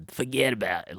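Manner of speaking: put-on softly tossed-off New York wiseguy accent; a light New York gangster impression; New York mobster accent. Mimicking the actor's accent for friends, light New York mobster. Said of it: "this Andy Garcia guy. Like, he has".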